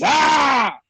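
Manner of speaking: loud, high drawn-out "ah!" yell; comic high-pitched holler at full volume; long, piercing mock-outraged shout; Dave Chappelle. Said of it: "in my Dave Chappelle voice".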